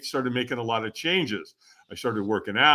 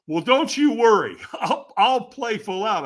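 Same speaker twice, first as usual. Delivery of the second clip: sarcastic laugh; 10 semitones higher, 5 dB louder than usual. Retold or imitated. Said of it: "And I went".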